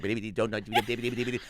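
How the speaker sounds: rapid, stuck cartoon stammer; stuttering Porky Pig. Cartoon pig answering the impatient dog, helpless stutter. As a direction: keep tripping over the warning. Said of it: "he couldn't get the words out".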